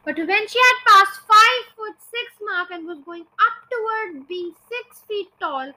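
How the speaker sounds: ordinary delivery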